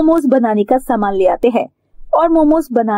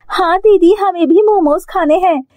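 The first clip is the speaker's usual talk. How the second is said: put-on much higher, childlike and keen; eager little girl; 8 semitones higher than usual. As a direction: answer like a small girl wanting momos. Girls answer the didi. (छोटी बच्ची की ऊँची, उत्सुक आवाज़)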